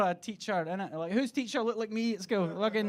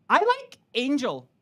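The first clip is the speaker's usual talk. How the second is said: mock kid answer; 6 semitones higher, 9 dB louder than usual. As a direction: voice the shy kid proudly. Quiet kid's answer.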